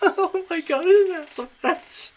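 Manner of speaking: loud, very high giddy squeal; freaking out. Real, not acted. Host overwhelmed by the booking, freaking out. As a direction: burst out overwhelmed with excitement. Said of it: "I'm freaking out here".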